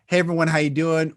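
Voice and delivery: breezy, rushed hello; fast casual greeting to a room; quick greeting. Shown as a demonstration. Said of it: "You could say".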